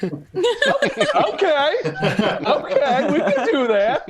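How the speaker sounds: high, giggling agreement; a nurse cracking up as she agrees; laughing nurse. Retold or imitated. Nurse laughing at his sexy-knee request, high giggling agreement.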